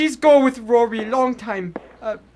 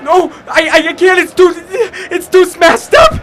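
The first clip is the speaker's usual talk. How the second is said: pained pleading; 8 semitones higher, 8 dB louder, slower than usual. Acted. Hand smashed, forced to fight.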